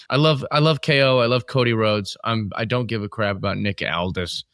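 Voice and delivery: ordinary delivery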